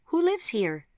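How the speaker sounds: put-on high, loud little-girl voice; small child. Little Sophie asks.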